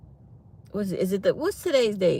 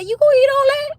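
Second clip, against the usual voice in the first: high, loud mock disbelief at his steak; incredulous tease; 6 dB louder, faster than usual. Teasing her man over his steak, high incredulous squeal.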